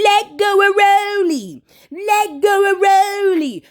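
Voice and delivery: high, slow, drawn-out pleading; a hammed-up chant of onlookers; mock crowd pleading. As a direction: imitate the crowd begging her to let go. Crowd pulling girl off Rolly.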